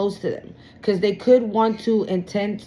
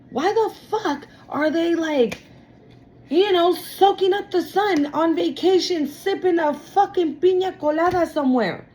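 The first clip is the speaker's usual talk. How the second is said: high, indignant jealous voice, raised up; voicing the envious person's whining complaints; mock jealous rant; 9 semitones higher than usual. Tarot reader voicing jealous person, high whiny indignation.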